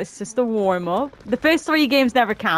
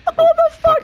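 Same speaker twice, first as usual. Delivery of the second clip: high, loud startled outburst; shocked yelp; 8 dB louder, faster than usual. Real, not acted. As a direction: blurt it out in real shock. Getting shot up in game.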